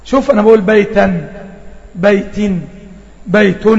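بيتًا بيت بيت with a clearly held silent n; teacher asking if the n is voweled or silent; tanween demo. Shown as a demonstration. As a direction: let the final n ring out. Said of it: "سمعتها نون ساكنة ولا متحركة؟".

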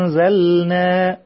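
وأنزلنا with the noon hidden, a held nasal before the zay; ikhfa on noon. Shown as a demonstration. Teacher shows the children ikhfa. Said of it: "الإخفاء".

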